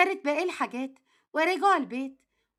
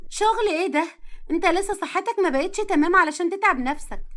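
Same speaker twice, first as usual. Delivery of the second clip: worried protesting wife; 3 semitones higher, 4 dB louder than usual. Acted. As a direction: protest as a wife fretting over her husband's health.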